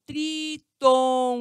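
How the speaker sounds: "tritongo" drawn out as tri-ton-go; emphatic syllables. Shown as a demonstration. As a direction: stretch "tritongo" very slowly in three beats. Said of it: "aqui são três vogais".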